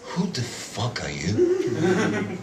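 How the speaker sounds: slow, low, soft mock astronaut; slow incredulous mumble, imitated; exact mumbled intonation. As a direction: draw it out slowly, softly, rising on "you". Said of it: "to be more precise with, uh, transmission of his intonation".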